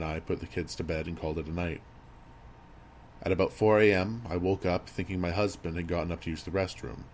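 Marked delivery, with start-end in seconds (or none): none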